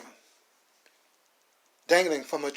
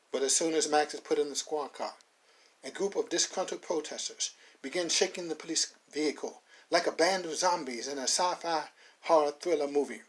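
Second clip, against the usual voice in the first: normal talking voice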